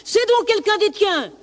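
very high, heated accusation; wolf's angry outburst; accusing wolf. Acted. Wolf accuses lamb in acted quarrel.

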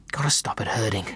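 plain reading voice

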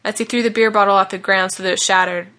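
usual speaking voice

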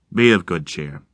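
soothing yet ghostly; eerie deep voice. Acted. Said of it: "sounding both deep and insubstantial".

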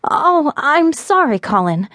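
embarrassed, rushing the sorry; flustered apology. A narrator's reading. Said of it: "she stammered".

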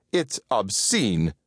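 slow, severe pronouncement. A narrator's reading. Winifred condemning his viewing, severe and slow. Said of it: "said Winifred severely".

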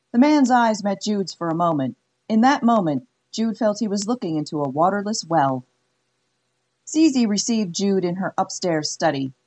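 ordinary delivery

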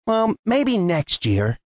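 slow, sighed and let down; a small tree giving up for this year, slow; sighing. Acted. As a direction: let the hope drain out slowly. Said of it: "sighed Timmy".

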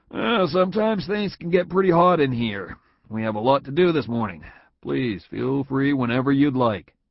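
a hint of a hospitable manager; genial boss. A narrator's reading. Boss offering Tom a drink, lightly voiced and friendly.

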